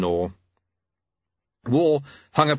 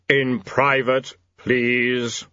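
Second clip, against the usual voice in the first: slow, emphatic thundering as Death; much slower than usual. A narrator's reading. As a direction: thunder it out word by word. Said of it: "Death thundered".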